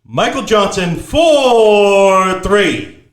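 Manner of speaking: loud drawn-out announcer call; three-pointer call. Shown as a demonstration. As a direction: stretch it out big for the three. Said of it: "But if it's a three-pointer".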